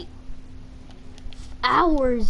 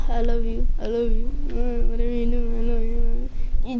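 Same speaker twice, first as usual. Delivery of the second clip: imitated dying farewell, run together on one level tone; mock movie deathbed murmuring, words tumbling out; dying man's last words. Stepdad to stepson in the car, mock dying farewell. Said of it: "Just like those last words".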